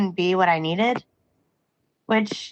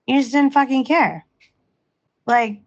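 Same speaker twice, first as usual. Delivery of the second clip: normal talking voice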